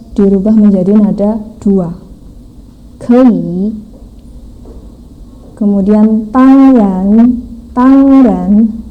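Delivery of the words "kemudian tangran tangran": "tangran": flat tang, rising ran; tangran in Mandarin tones. Shown as a demonstration. Teacher modelling "tangran" for students, slow and rising. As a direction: say "tangran" with a level first syllable and rising second. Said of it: "Tang, A-nya ada tanda nada satu".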